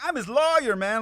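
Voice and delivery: very high, loud imitation; hammed-up exclaiming. Lawyer explaining himself to the American, mock high-pitched exclaiming. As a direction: plead it in a high, excited mock voice.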